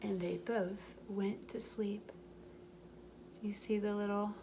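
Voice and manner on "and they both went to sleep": gentle storybook read-aloud; reading to children. A narrator's reading. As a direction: tell it like a bedtime story for little ones. Reading picture book aloud.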